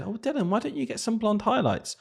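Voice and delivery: high, soft imitation of mum; lightly coaxing mum, pitched well up; high-pitched retold mum; mum's suggestion. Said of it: "my mum said".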